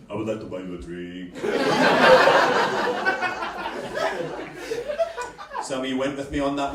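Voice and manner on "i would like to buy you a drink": put-on very low, softened Nigerian accent; comic Nigerian accent, pitched right down. Nigerian chatting up a girl in a bar, Nigerian accent. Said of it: "you want to do a Nigerian accent".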